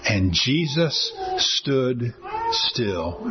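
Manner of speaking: very slow, each word drawn out; hushed, awed slow-motion reading; slow dramatic reading. Real, not acted. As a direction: let the moment hang, word by word. Preacher repeats climactic verse.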